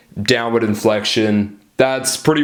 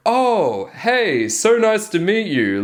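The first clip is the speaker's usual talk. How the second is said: high, swooping supplicating tone; over-eager, high-pitched pleaser; people pleasing; 9 semitones higher, slower than usual. Shown as a demonstration. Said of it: "supplicating, people pleasing kind of tonality".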